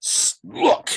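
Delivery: the Lord's sharp command to look; stern command. Retold or imitated. The Lord telling him to look, sharp command. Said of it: "and he says".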